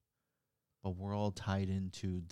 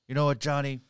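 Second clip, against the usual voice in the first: put-on drunk voice; 5 semitones higher, 10 dB louder than usual. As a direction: bellow it like a drunk at the bar. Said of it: "That's my drunk voice".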